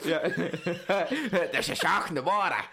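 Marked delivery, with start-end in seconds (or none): 1.5-2.6 laughing, fast Aussie voice, higher and louder; silly Australian voice; mock Australian. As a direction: ham up an Australian accent.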